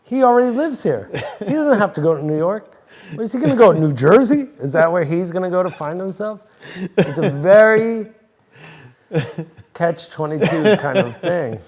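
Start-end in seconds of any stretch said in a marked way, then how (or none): 0.0-6.4 high, loud, amused rant; loud laughing mock rant. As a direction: rant it while laughing at the irony. Mock rant about son.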